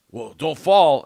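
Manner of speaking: loud, high mock warning; drunk guy. Drunk guy warning the climber, loud drunk-guy voice. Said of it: "And he's like".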